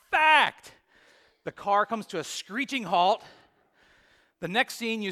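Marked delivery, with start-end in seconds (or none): 0.1-0.7 high, loud burst of fact; blurted shout. Retold or imitated. Brother blurting at his brother in the car. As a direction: explode with it after holding it in. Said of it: "he blurts it out as loud as he can".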